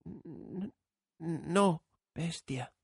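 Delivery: put-on a scared girl stammering; frightened. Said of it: "estremeciéndose".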